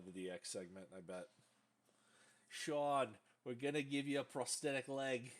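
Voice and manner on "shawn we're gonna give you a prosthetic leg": loud, laughing bad New York accent as Russo pitching the angle. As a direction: ham up a terrible New York accent, cracking up over the pitch. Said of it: "That's a horrible New York accent".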